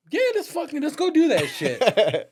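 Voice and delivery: high, slow, laughing hype; mock eager yell. To the homies about robbing the store, hyped and laughing. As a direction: sell the bad idea like it's brilliant.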